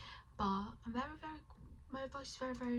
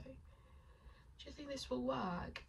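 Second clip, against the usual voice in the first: ordinary delivery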